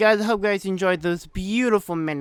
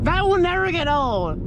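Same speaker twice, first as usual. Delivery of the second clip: excited; 9 semitones higher than usual. Real, not acted. Thrilled after the engine pop.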